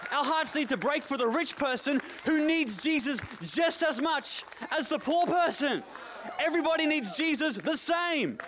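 high, driving sermon delivery; fired-up preacher building to a shout; impassioned preaching. Real, not acted. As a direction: preach it hard to the back row. Preacher at full force.

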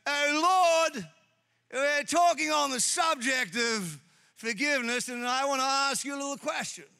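self-important Peter. Acted. Peter putting a loaded question to the Lord, mock self-important. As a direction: play a hammed-up loaded question to the master. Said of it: "Peter comes down and goes".

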